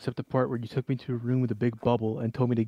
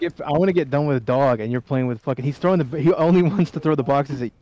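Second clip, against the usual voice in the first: frustrated loud; 3 semitones higher, 8 dB louder than usual. Real, not acted. Frustrated complaint, raised voice.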